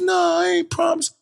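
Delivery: very high imitation of an indignant denial; protesting cousin. Cousin denying he promised anything, indignant.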